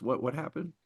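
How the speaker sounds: ordinary talk, nothing marked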